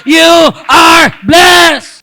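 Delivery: shouted, high preaching climax; fervent pulpit shout; preaching at full force. Acted. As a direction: proclaim it to the whole church.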